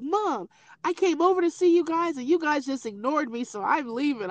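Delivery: sulky daughter. Retold or imitated. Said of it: "She's like".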